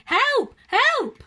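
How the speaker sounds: loud, high desperate shout. A narrator's reading. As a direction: yell for help at full voice. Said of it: "and then very loudly".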